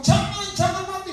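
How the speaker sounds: high-pitched mock pleading; pleading bystanders, imitated. Onlookers beg the woman not to die, high imitated plea.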